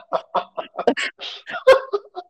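giggling laugh; a burst of suppressed giggles; stifled giggles. Real, not acted. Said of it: "Hör auf zu lachen".

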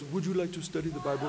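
put-on softly spoken French accent. French brother inviting a newcomer to Bible study, French accent. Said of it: "he had a French accent".

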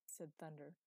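plain reading voice

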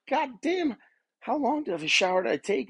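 mock-shocked self quote; disbelief. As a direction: replay your own shock at the time. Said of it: "I was like".

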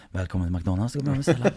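lightly voiced low employee greeting; drive-thru employee. Employee greeting a drive-thru customer, lightly mocked low voice.

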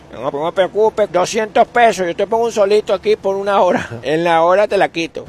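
high, loud mock sales pitch; eager salesman, hammed-up. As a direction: pitch a silly deal at full volume. Acts out company selling sunshine.

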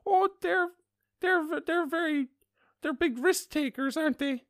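put-on loud, very high old-lady voice; old woman. Old woman remarking on jaywalkers, high mock voice. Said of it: "this very old woman next to me".

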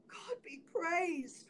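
joyful thanks, almost whispered; hushed joy. A narrator's reading. Wife learns her brothers are near, hushed joyful relief. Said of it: "replied the poor woman joyfully".

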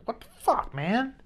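jumpy high-pitched what the fuck, partly breathless; startled yelp. Retold or imitated. Man startled by a barking dog, high startled yelp. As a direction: react like a barking dog just made you jump.

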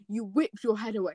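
ordinary delivery

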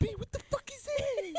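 very high, loud imitated shriek; mock terrified scream. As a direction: mimic a friend screaming for their life. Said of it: "you literally scream for your life".

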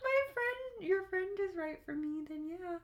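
man doing a woman's voice. Retold or imitated. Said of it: "He was like".

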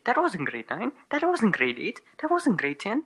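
rising, whiny mock questions; mocking imitation. Mocking friend's repeated phrase.

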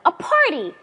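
high, loud happy cry; excited shout. Acted. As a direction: shout out the sudden idea. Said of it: "I shouted".

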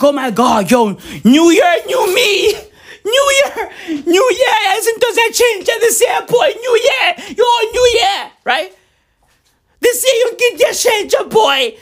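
high, shouted, laughing mock excitement; hammed-up high squeals of new-year excitement; mock new-year hype. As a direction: ham up the giddy "new year, new me" crowd. Mocking everyone's new-year hype.

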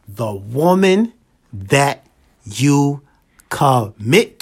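each word drawn out with long gaps; said slowly. Shown as a demonstration. To the ladies, repeating his point very slowly. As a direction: spell it out word by word, very slow. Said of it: "I'ma say it slow".

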